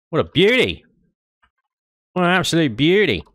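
high, exuberant Australian twang; Australian accent. Acted. Player admiring the parked ship, mock Australian accent. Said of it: "I went Australian for a second there".

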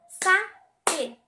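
"Sapé" split in two loud beats, Sa - pé; syllable by syllable. Shown as a demonstration. Teacher counts syllables with claps, "Sapé" chanted as Sa, pé. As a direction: punch each syllable of "Sapé" separately. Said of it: "Vamos tentar fazer com palmas?".

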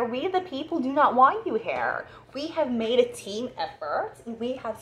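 hammed-up "we the people" announcement; mock group verdict. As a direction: pronounce it like a council casting someone out. Voicing the group rejecting her.

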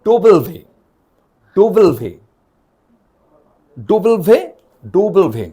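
ডবল ভে with a v sound; Danish W. Shown as a demonstration. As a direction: say ডবল ভে, ending in ve. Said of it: "এইভাবে আমরা উচ্চারণ করতে পারি".

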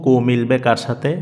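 usual speaking voice

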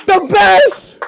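high, loud excited shout. Real, not acted. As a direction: yell it with delight. Meeting the singer.

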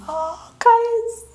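very high, drawn-out "oh"; swooning high over a sweet memory; high emotional gush. Real, not acted. Overcome recalling being handed baby.

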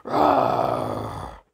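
long voiceless breath of a roar; lion roar impression. Shown as a demonstration. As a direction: imitate a lion with a drawn-out, airy roar. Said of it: "It's my lion impression".

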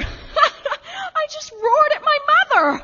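high, slow, half laugh and half sob; breaking up in disbelief; laughing through tears. Acted. Lauren to Rena, laughing and crying over the fight.

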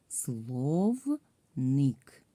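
"Словник" slowly, in two separated syllables; split into syllables. Shown as a demonstration. Teacher divides the word for pupils, "Словник" syllable by syllable. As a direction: break "Словник" into слов and ник, stretched out. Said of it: "ділимо на склади".

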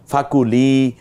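ফাকুলি with the li held long; lengthened li. Shown as a demonstration. Teacher to students, drawing out the li in ফাকুলি. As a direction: stretch the li in ফাকুলি. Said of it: "লিতে টানলাম কেন?".

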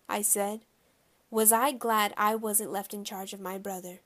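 ordinary delivery

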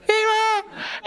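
very high, thin squeal for mom; squeaky real cry. Retold or imitated. Kid actually stuck in the pit, tiny squeaky cry for mom. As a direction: squeal it like a scared little kid. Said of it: "במציאות זה היה".

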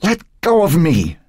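put-on high, strained old woman's demand; angry demand. A narrator's reading. Old woman struggling in a fireman's grip, demanding. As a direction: demand release, pitched high. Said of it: "Emily Moore demanded".

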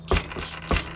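sharp voiceless "tss" repeated; monkey chatter "tss". Acted. Monkeys stamp back at the peddler, tss noises. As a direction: spit out three short tss sounds. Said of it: "monkeys only stamped their feet back and said".

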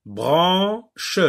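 loud, high "Branche" as bran, che; long first syllable in "Branche", then a separate che; split into syllables. Shown as a demonstration. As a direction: sound out "Branche" in two firm beats. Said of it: "sépare".

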